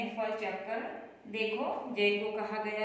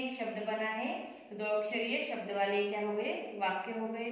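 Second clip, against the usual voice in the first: ordinary talk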